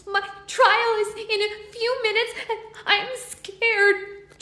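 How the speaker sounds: fairy fearing her trial; scared fairy. Acted. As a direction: speak small and frightened. Fairy says she's scared.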